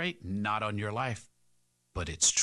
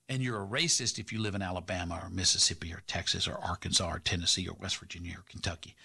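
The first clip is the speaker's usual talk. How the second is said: ordinary talk, nothing marked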